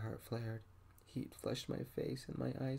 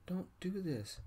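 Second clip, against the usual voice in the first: higher-pitched plea, mildly raised; understated yell; 7 semitones higher than usual. A narrator's reading. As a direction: protest back in a restrained yell. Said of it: "I yelled back".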